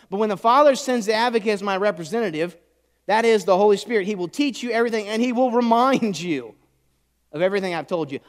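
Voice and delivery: high, forceful preacher's voice; full-force preaching. Real, not acted. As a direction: proclaim the verse to the room.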